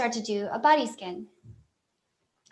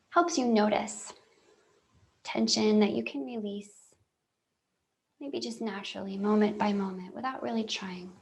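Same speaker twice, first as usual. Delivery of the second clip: guided meditation. Real, not acted. Telling listeners it's okay to just notice, calm guided meditation. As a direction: talk them gently through noticing, with long silences.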